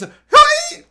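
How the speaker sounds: very high, loud mock "Hey!"; imitating the haunted-house performer's silly yell; ridiculous shriek. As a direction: mimic the actress's silly squeal. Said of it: "makes a ridiculous noise".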